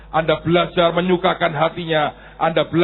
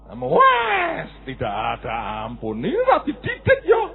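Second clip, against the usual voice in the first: mock angry elder; 6 semitones higher than usual. Kakek marah.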